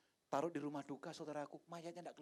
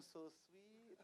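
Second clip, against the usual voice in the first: soft, fond voice of the wife; wife's cooing; 5 semitones higher, 15 dB quieter than usual. Retold or imitated. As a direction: coo it like a touched wife. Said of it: "istri saya bilang". (suara istri yang lembut dan manja)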